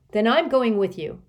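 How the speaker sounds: high, loud insistence; fierce daughter's retort. A narrator's reading. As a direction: refuse to be left behind. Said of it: "Kate retorted fiercely".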